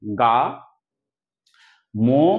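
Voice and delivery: গা and মো each alone, a long pause between; split into syllables. Shown as a demonstration. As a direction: say গা, stop, then মো as its own unit. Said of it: "তিনটে পার্টে উচ্চারণ করতে পারবো".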